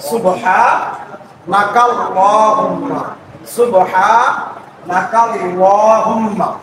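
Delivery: সুবহানাকাল্লাহুম্মা drawn out syllable by syllable; very slow recitation. Shown as a demonstration. Teacher modelling the phrase very slowly. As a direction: stretch every syllable, joined. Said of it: "এভাবে পড়াটা".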